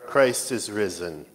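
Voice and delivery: ordinary delivery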